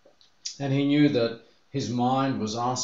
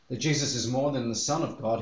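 ordinary talk

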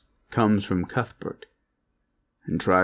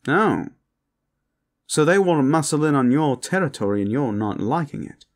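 higher-voiced, knowing vampire; posh vampire; 7 semitones higher, 4 dB louder than usual. Vampire needling partner about the territory, high and knowing. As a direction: play a teasing posh vampire catching on.

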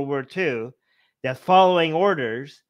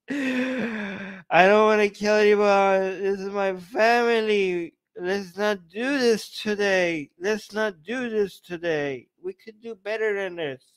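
slow, higher-pitched fake sobbing; mock tearful; 5 semitones higher, slower than usual. Arjun begging not to kill family, mock weeping. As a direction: drag it out in a whimpering mock cry. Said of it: "like, in tears".

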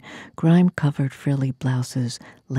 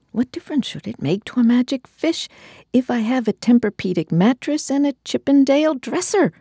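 indignant high; 9 semitones higher than usual. Acted. Indignant rhetorical question.

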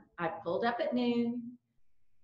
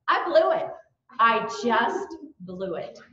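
usual speaking voice

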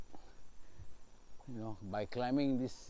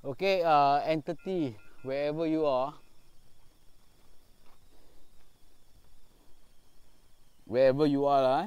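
louder, calling out into the dark; louder call to an unseen presence; calling out to spirit; 3 dB louder than usual. Real, not acted. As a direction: summon the entity, projecting. Calling out to the entity.

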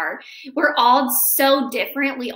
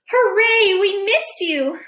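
loud happy hooray; cheering; 7 semitones higher, 4 dB louder than usual. Acted. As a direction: cheer them back.

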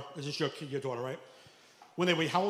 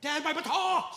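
put-on much higher gleeful kid shout; excited kid; 10 semitones higher, slower than usual. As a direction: squeal it like a thrilled boy. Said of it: "He's got a batar".

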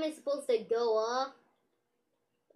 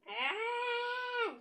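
high shrill scream of panic; 12 semitones higher, 4 dB quieter than usual. Pretend. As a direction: scream as if dangling. Joker dangled by Batman.

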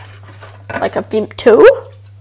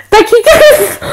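excited outro; 8 semitones higher, 4 dB louder, slower than usual. Shown as a demonstration. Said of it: "It'll be like this. Like, watch".

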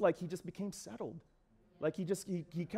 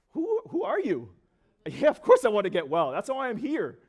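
attitude; 3 semitones higher, 7 dB louder than usual. Acted. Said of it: "He probably had some, but if that were me".

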